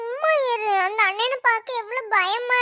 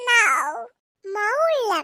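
usual speaking voice

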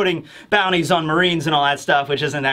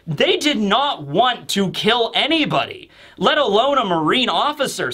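usual speaking voice

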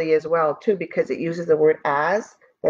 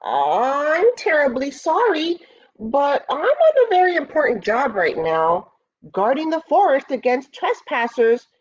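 higher-pitched boar making excuses; lightly voiced self-important boar; blustering boar; 7 semitones higher than usual. A narrator's reading. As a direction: bluster through an apology, pitched up. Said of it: "he grunted loudly".

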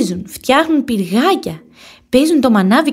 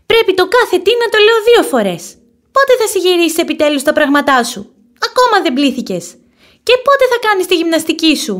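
fed-up mother snapping, louder and higher; angry; 9 semitones higher, 5 dB louder than usual. A narrator's reading. Mother rabbit scolding her son, angry and loud. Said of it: "λέει θυμωμένη".

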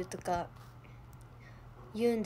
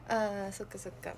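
usual speaking voice

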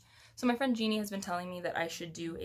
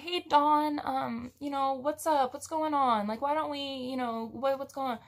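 soothing coworker; 6 semitones higher than usual. Retold or imitated. Coworker soothing the angry customer.

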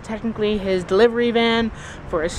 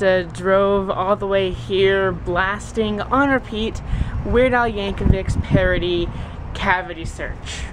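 ordinary talk

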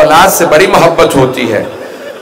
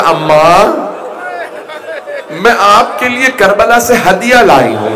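tearful plea; 5 semitones higher than usual. A narrator's reading. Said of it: "Zainab कुबरा ने रो के कहा".

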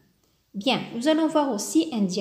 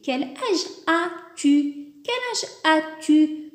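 slow, higher question voice; slow model question; 5 semitones higher, slower than usual. Shown as a demonstration. Teacher modelling the inverted question slowly for learners. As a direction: ask it slowly, stressing the inversion. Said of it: "on a dit".

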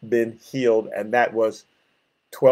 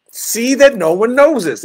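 acted stern warning, higher and louder; vehement threat; 8 semitones higher, 5 dB louder than usual. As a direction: warn them with mock menace. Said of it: "saying it vehemently in a threatening way".

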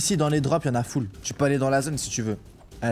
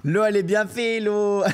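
mocking imitation; 5 semitones higher, slower than usual. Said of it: "arrête de te moquer de moi".

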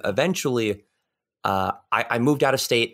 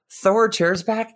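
ordinary delivery

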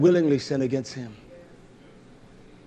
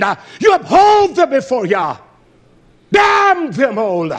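loud, high fiery preaching; preaching at full force; 12 semitones higher, 7 dB louder than usual. Acted. Preacher condemning wickedness before Yah. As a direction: thunder the damnation.

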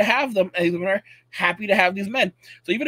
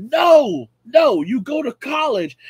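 loud, firm no, then slower; stern boomer command; 6 semitones higher, 8 dB louder, slower than usual. Retold or imitated. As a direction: shut the kid down hard. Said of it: "The Uber's like".

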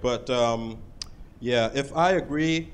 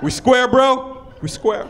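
cool dude; 9 semitones higher, 6 dB louder, slower than usual. Retold or imitated. Accepting apology coolly.

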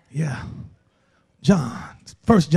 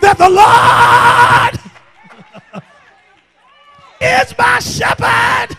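full-force preaching; 11 semitones higher than usual. Real, not acted. Preacher confessing his shame to the church, impassioned preaching. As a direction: preach it out, building higher.

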